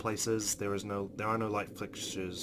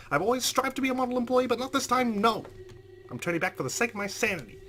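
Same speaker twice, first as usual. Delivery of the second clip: loud, high-pitched indignant rant; frantic, exasperated tirade; panicked outburst; 9 semitones higher, 7 dB louder than usual. Acted. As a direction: rant at the top of the voice, fed up and scared. Character's panicked outburst, leaving haunted house.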